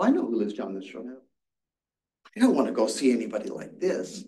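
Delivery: usual speaking voice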